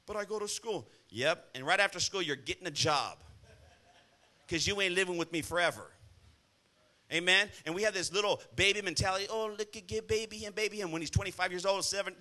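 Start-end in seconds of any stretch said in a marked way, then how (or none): 0.1-0.9 much softer mock teen protest; whiny teen excuse. Pastor mimics a teen's excuse, soft whine. 9.3-10.9 soft mock baby-talk coo; coddling parent. Pastor mocks parents babying a son, cooing.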